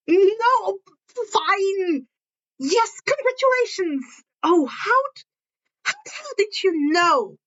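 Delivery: high, cracking, caught-out voice; high, panicky admission; flustered Izuku. Acted.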